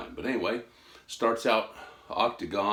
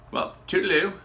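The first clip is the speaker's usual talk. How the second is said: mock farewell; 10 semitones higher, 8 dB louder than usual.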